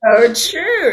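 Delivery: high, loud laughing reply. Real, not acted. Laughing at the Sandra pun.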